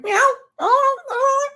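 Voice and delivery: high, whimpering meow; mock crying meow. Crying dancer standing on stage, high whimpering meow. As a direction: whimper like a lost cat.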